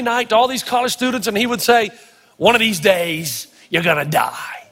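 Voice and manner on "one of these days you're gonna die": much lower, slow, ominous preacher voice; the old pastor's warning. Retold or imitated. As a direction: deliver it like a grave old pastor. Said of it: "I wish I could say it like him".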